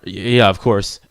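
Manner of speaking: stammered start, then low reply; low-voiced protagonist. Redoes line in lower character voice.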